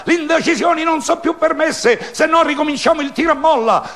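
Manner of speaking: high, loud, fast mob rant; angry crowd. A narrator's reading. Crowd threatening dissenters, loud high angry rant. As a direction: rush it out like a furious mob. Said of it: "urlò la folla".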